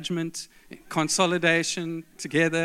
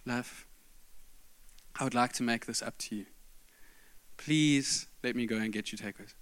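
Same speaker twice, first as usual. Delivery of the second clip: soft pleading; 5 semitones lower, 11 dB quieter than usual. Retold or imitated. Pleading to make it up.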